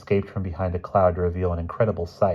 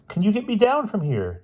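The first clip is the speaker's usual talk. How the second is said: calling down; 14 semitones higher than usual. A narrator's reading. Stranded boy calls down.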